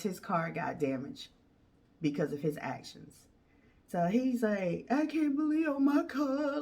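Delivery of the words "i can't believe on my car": put-on whiny boyfriend. Boyfriend whining for sympathy.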